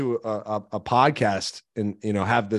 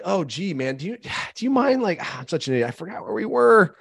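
higher, whiny mock apology; apologetic; 6 semitones higher than usual. Shown as a demonstration. Speaker who lost his place, mock self-pitying apology. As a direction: fumble it like a flustered speaker, pitched up. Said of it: "Like, not like".

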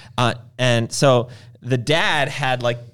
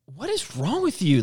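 higher, drawn-out exasperated question; mad mother; 6 semitones higher than usual. Retold or imitated. Wife to prankster husband holding baby, mildly mad. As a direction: imitate a wife getting mad, stretching the words. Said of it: "she starts getting really mad".

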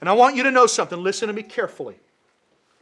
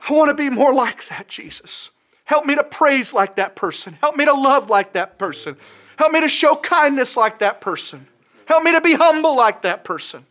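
louder pleading prayer, building; earnest longing prayer; 5 semitones higher, 5 dB louder than usual. Acted. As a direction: beg God to be like that person. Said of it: "here's what change looks like".

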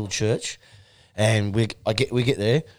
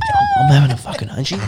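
mock panic; 5 dB louder than usual. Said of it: "I was like".

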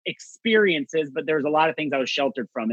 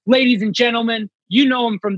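emcee intro; 6 semitones higher, 4 dB louder than usual. Retold or imitated. Announcing comic on stage.

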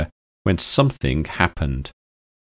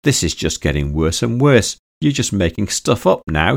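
plain reading voice

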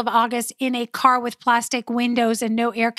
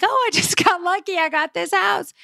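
gleeful; 6 semitones higher than usual. Retold or imitated. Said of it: "I was like".

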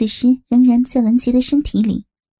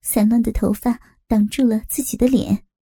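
ordinary delivery

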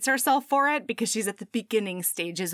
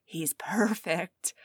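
awed whisper; slower than usual. Acted. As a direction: whisper it in awe. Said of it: "she's standing there being like".

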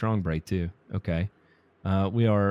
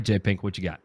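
normal talking voice

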